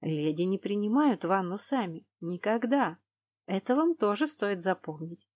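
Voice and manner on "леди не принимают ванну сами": ordinary delivery, nothing marked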